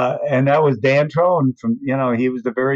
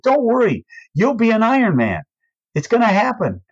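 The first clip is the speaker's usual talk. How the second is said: reassuring; 9 semitones higher than usual. Retold or imitated. Reassuring nervous athlete.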